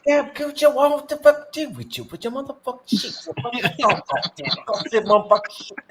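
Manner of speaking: high, slurred mock Scottish drunk; hammed-up Scottish accent, high and garbled. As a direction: imitate a drunk Scot rambling. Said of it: "he's got that strong accent".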